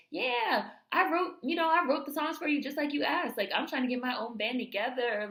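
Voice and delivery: high-pitched imitation of an eager young musician; eager to please. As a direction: pitch it up, chummy and ingratiating.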